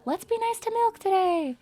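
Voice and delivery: high, sweet-talking singsong; wishful high-pitched niceness; nice-stream cheer. Pretend. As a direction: pitch it up, all kindness. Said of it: "Instead of, like, a ... That's your guys' voice".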